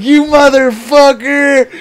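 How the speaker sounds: high, loud, slow outraged cry; hammed-up rage through tears; mock furious yell. Crying brother furious at prank.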